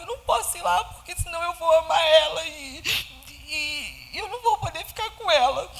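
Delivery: halting as feeling takes over, real; choked up. Real, not acted. Mother recalling fear of losing her newborn, choking up.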